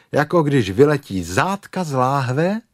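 usual speaking voice

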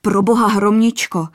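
sudden appalled exclamation; horrified outburst. A narrator's reading. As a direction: blurt it out in horror. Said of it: "vyhrkl zděšeně".

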